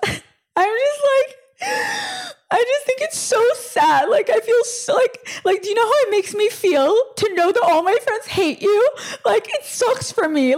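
high-pitched, exaggerated whiny sobbing; mock crying. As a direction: imitate a friend bawling her way through the words. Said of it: "Why are you crying".

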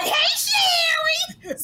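very high, drawn-out flamboyant greeting; Wendell, flamboyant. Retold or imitated. Flamboyant friend greeting Sherry on FaceTime, high showy squeal. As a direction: sing out a showy, squealed hello. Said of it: "Wendell's very flamboyant".

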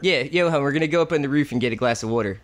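casual Philadelphia accent demo; Philly accent. Shown as a demonstration. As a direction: do a local Philly accent, chatting to hon. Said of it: "what is the Philly accent?".

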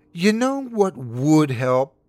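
slow, high, loud sarcastic question; thick sarcasm. A narrator's reading. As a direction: draw it out with heavy sarcasm. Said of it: "her voice thick with sarcasm".

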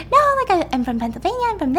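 high-pitched mock babble; chattering Ingrid. Storyteller mimics Ingrid's park chatter, in exaggerated high nonstop babble. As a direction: imitate someone who rattles on without stopping. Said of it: "at the park she was all like".

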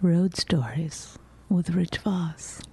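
slow whispered title card; slow mock announcer whisper. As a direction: tease it like a trailer voiceover.

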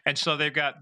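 ordinary delivery

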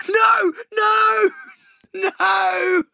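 long high wailing "no"; high-pitched howl of embarrassment; anguished wail. Real, not acted. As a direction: cry out in drawn-out mortified protest. Agonised reaction to embarrassing story.

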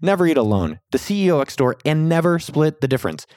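upbeat promo read with lifted pitch swings; podcast intro read. Acted. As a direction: sell it to the listeners, punching key words.

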